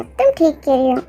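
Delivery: ordinary delivery, nothing marked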